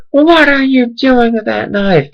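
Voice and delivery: high, slow mock lady voice; lady yelling. Shocked stranger shouting at a runner, high mock lady voice. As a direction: imitate a shocked lady calling out. Lady yells at him about knife.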